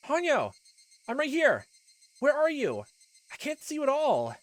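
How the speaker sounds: high, loud desperate calling. Acted. Rika calling for Hanyo, frantic shout. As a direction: shout into the dark for her friend.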